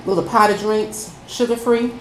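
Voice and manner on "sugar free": ordinary delivery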